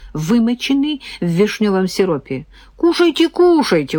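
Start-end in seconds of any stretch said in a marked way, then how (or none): 2.8-3.3 high, eager offer; coaxing. Acted. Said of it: "угощал парень". 3.3-4.0 falling, pressing repeat; insistent. Acted. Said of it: "угощал парень".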